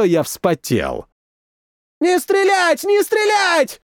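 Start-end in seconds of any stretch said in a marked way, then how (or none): 2.0-2.8 angry cracking shout. A narrator's reading. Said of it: "кричал кто-то из наших злым срывающимся голосом". 2.8-3.8 angry cracking shout. A narrator's reading. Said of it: "кричал кто-то из наших злым срывающимся голосом".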